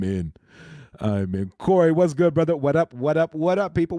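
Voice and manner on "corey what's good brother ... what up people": loud, high, hyped-up welcome; pumped-up stream greeting to chat. Real, not acted.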